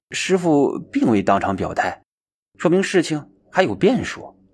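usual speaking voice

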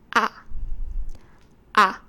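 open, loud vowel a, held clearly; Spanish vowel a. Shown as a demonstration. Teacher modelling the Spanish vowel a, loud and open. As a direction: sing out a wide-open 啊 like a teacher. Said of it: "那第一个是".

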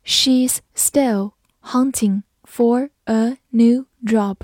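very slow, word-by-word model reading; slow read-through. Shown as a demonstration. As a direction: read slowly, pausing between words. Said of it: "慢读一遍". (放慢速度，一词一顿地读)

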